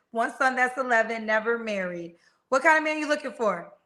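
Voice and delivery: usual speaking voice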